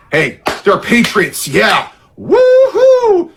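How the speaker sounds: sneer, then whoop like a rally fan; mock cheer. Mocking cheering for rioters.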